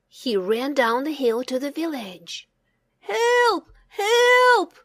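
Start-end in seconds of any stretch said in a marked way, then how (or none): 3.0-4.8 high, loud panicked cry of help; frantic shout for help. A narrator's reading. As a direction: yell for help across the village. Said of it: "he shouted".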